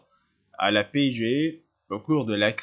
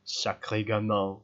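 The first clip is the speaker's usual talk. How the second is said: grumbled; 2 semitones lower, slower than usual. A narrator's reading. Maigret grumbling to himself about the boy at the window, slow grumble. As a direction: grumble it under your breath, slowly.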